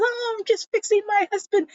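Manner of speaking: squeaky high, quick and innocent; high-pitched fake-innocent wife; high mock wife voice. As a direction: play the wife sweetly, high and fast. Voicing the wife, high and fast.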